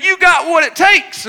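high, loud encouraging shout; acted-out dad's shout; calling out. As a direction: holler it across the valley. Dad calls out to make echo.

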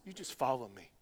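soft, quiet "just follow me"; calm a worried believer; hushed, tender call to follow; gentle reassurance. Retold or imitated. Lord's gentle answer.

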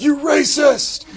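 high, slow playful outcry; mock accusation. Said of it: "I'm just like".

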